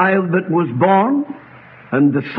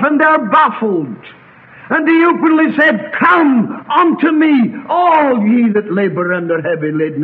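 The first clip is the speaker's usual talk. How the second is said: higher-pitched, insistent sermon delivery; impassioned preaching; 6 semitones higher, 3 dB louder than usual. Real, not acted. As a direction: preach it out to the congregation. Preacher building in full flow.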